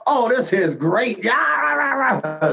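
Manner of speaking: mock cheer, jumping high on rah; imitating excited scream. Prince reacting to the bootleg cover, mock excited cheer. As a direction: retell an excited outburst, hammed up. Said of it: "he screamed in excitement".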